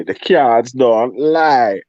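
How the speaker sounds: drawn-out, loud Jamaican delivery; Jamaican accent. Shown as a demonstration. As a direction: stretch it out slowly in a Jamaican accent. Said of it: "Say this in your best Jamaican accent".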